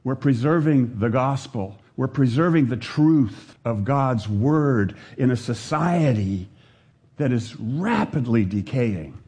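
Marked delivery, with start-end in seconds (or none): none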